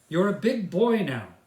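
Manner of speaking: dad insisting his son sleep alone, firm; father's voice. Acted. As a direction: tell him he's grown up now. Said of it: "said Chris's father".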